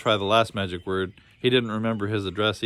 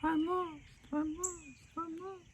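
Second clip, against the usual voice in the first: very high, ever softer echo; fading echo; 11 dB quieter, slower than usual. A narrator's reading. Echo of the lost boy's voice dying out in the maze. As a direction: let it ring away into the distance. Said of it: "the lonely echo of his voice".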